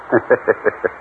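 laugh. Acted. Husband laughs off death news.

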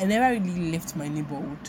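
normal talking voice

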